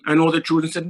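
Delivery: usual speaking voice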